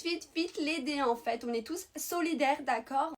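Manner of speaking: normal talking voice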